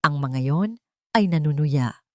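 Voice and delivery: ordinary delivery, nothing marked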